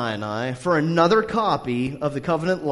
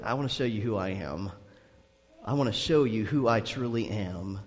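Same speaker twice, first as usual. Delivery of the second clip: gentle God; 7 semitones lower, 6 dB quieter than usual. Pretend. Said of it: "He's like".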